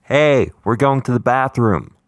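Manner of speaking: half-hearted yell across a distance; mock yell. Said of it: "yelled across".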